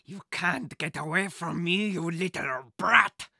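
slow, taunting villain voice. A narrator's reading. Thief chasing the boy through the cars, slow taunt. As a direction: drag it out as you hunt him. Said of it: "Carl said".

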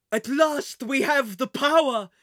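loud, slow villainous gloating; triumphant proclamation. A narrator's reading. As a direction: proclaim victory grandly. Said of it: "he proclaimed".

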